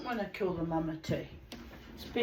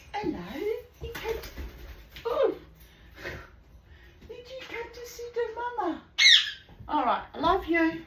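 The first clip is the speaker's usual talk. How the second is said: much higher, sing-song pet voice; 14 semitones higher, 4 dB louder than usual. Real, not acted. Owner greeting her bird in high baby talk.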